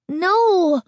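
put-on high, drawn-out boyish cry; high-pitched startled protest; frightened cry. A narrator's reading. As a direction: cry out like a scared boy. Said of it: "one of the boys cried".

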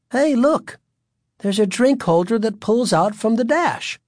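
high, excited mother's voice; exclaiming. A narrator's reading. Mother pointing out the drink holder, exclaiming. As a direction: exclaim it high, delighted at a discovery. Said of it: "Greg's mother exclaimed".

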